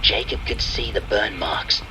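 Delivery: ordinary delivery, nothing marked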